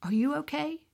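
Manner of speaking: soft, lingering question, lifted at the end; gentle inner echo. Retold or imitated. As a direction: let it echo softly, tenderly. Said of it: "that rang in my spirit".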